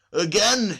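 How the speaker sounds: a loud, high despairing cry. A narrator's reading. Kukin looking at the sky, a loud despairing cry. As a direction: wail it at the sky like it's the last straw. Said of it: "he cried in despair".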